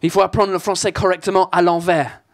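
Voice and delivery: French spoken with a thick put-on English accent. Comedian on stage hamming up an English accent. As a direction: play the Brit mangling French vowels. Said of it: "cet accent de merde".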